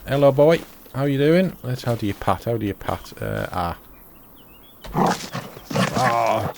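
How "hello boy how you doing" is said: doggy talk. Real, not acted. Greeting the new dog.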